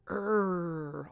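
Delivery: "er" held long on a growly R; grumpy R sound. Shown as a demonstration. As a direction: stretch "er" into a grumpy errr. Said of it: "that predominant grumpy sound".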